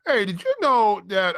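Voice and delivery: ordinary talk, nothing marked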